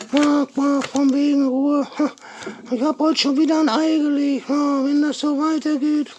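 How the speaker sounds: high, fast hen voice with quacks; cheeky puppet hen grumbling; Peggy. Pretend.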